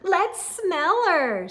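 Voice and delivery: slow taunt. A narrator's reading. Joe taunting slowly.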